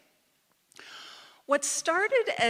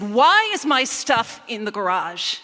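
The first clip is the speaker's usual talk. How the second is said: loud, slow, angry demand; storming demand; 4 semitones higher, 10 dB louder than usual. Retold or imitated. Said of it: "I stormed into the house".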